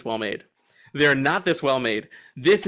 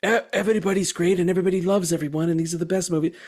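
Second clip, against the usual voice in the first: fast, higher, gushing fake praise; mock gushing; 4 semitones higher, much faster than usual. Mocking a flattering doc, gushing fast. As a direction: rattle off empty praise like a puff piece. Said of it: "it would be easy in a doc like this for it to be a little hagiographic".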